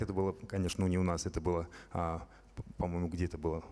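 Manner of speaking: ordinary talk, nothing marked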